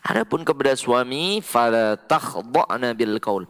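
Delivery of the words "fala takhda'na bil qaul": slow, measured recitation of the verse; Quran recitation. Shown as a demonstration. Preacher reciting the verse to the women, slow. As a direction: recite it slowly and carefully. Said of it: "Pegang ini baik-baik".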